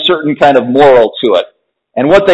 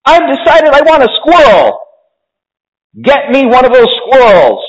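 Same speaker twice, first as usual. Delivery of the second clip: put-on high-pitched bratty child; Veruca shouting demands; 9 semitones higher than usual. A narrator's reading. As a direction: demand it like a spoiled little girl. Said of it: "shouted Veruca Salt suddenly".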